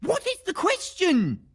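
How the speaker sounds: high-pitched, impatient outburst; exasperated demand. Acted. Badgered witness to the questioning officer, exasperated high-pitched demand. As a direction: demand it with the voice shooting up.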